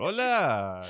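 "Hola" con acento israelí, agudo y fuerte; un saludo israelí exagerado. Retold or imitated. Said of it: "así hablan los israelíes con acento en español". ("Hola" agudo, con acento israelí)

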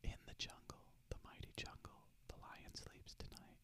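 near-silent breathy whisper, almost just mouthing; almost don't say it, just breathe; faint mouthed whisper; barely audible whisper. Shown as a demonstration. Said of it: "almost like, don't even say it".

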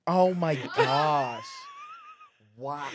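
stunned. Real, not acted. Stunned reaction to a crude joke.

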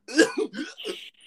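high, gagging cough impression; mock hiccupy gag; imitated retching cough. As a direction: mimic a friend's heaving cough. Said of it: "You, you went like this".

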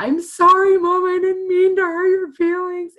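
put-on high, loud, sobbing little girl; crying child. Daughter apologizing to mom from back seat, high tearful wail. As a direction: wail the apology like a bawling kid. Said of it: "she just starts bawling".

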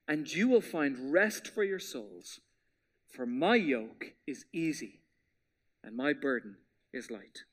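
slowed-down Bible reading trailing to near whisper; slow careful reading. Shown as a demonstration. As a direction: read each phrase slowly and gently, letting it sink in. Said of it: "I'm gonna slow down just a pinch".

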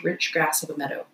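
plain reading voice

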